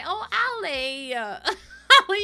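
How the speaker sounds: high, loud laugh-cry on the name; choked up and laughing at once; laughing through tears. Real, not acted. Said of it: "you're gonna make me cry as well".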